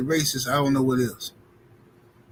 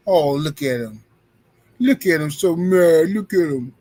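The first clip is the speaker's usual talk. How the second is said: ordinary talk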